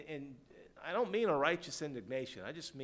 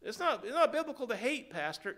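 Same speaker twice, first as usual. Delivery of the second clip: higher, louder protesting parishioner; mock objection; 7 semitones higher, 4 dB louder than usual. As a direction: object to the pastor in a raised voice. Voicing an objecting church member.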